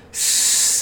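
loud, long sibilant hiss; upset creature hiss. A narrator's reading. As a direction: hiss out your frustration. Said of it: "more upset than ever".

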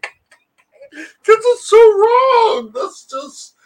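high, slow, breaking laugh-cry; so wrong it's funny; crying with laughter. Real, not acted.

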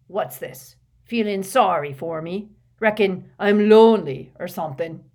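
loud, scornful questioning; indignant, building to a bellow; grumpy. A narrator's reading. Grumpy Hagrid challenges his visitors.

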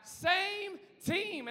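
high, drawn-out call, only lightly acted; lightly voiced sideline guy. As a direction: call it out like a mild sideline heckler. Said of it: "be that crazy guy on the sidelines".

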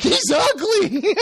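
high, drawn-out jeer; hammed-up squeal of contempt; mock disgusted insult. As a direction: imitate a girl's scornful cry, laughing. Acts out insult in quarrel.

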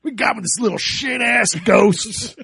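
put-on slow, shouted Maine accent; put-on Maine sheriff yelling in complaint; exasperated lawman with a Maine accent. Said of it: "he dropped that Maine accent".

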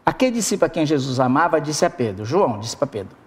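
ordinary delivery

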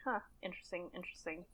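musing to herself. Retold or imitated. Reader musing quietly to herself over a noticed line. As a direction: quietly turn the word over, to yourself.

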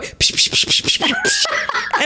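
high, laughing psh-psh slap sounds; laughing slap noises. Retold or imitated. Friend recounting the slap scene, laughing psh sounds. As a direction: mimic the slaps while cracking up. Imitating slaps while laughing.

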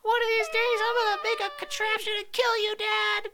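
loud, very high squeaky threat; tiny voice vowing revenge on dad; helium-voiced son. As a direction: play a vengeful kid shrieking on helium. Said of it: "sound like you've been inhaling helium".

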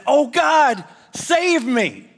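high, loud desperate plea; panicked cry. Acted. Atheist swimmer panics at the shark, high frightened cry. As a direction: cry out in terror to God. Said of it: "So he panics".

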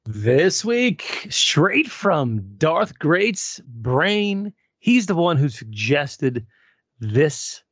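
slow, drawn-out billing of the main event; mock-dramatic announcer delivery; show announcer. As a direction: announce it with big stretched words. Announces tonight's matchup.

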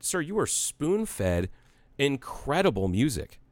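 drawn-out emphasis on "spoon-fed" and "incredible"; slow emphatic lecture. Retold or imitated. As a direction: spell it out slowly like a lesson he needs. Lecturing an older customer.

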